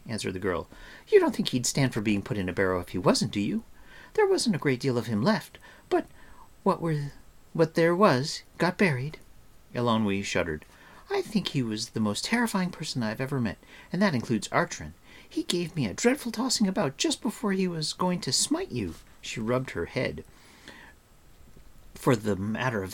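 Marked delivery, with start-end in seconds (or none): 1.1-9.2 lightly voiced chattery girl; a hint of a blunt girl; matter-of-fact girl. A narrator's reading. 11.1-18.9 put-on higher, quick girl's voice; lively high-pitched storytelling girl; chattering girl. A narrator's reading.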